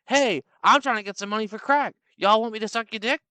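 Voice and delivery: brash offer from the back seat; woman voice. Retold or imitated.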